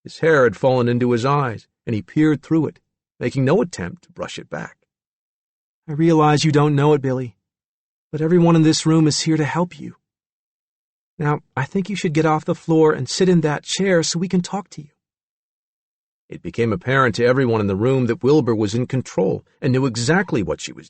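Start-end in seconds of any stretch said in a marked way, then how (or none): 5.9-14.9 lightly voiced, slightly raised pitch; gentle authority in a character voice; firm, kindly doctor. A narrator's reading. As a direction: steadily coax a frightened patient. Wilbur calming and directing Billy.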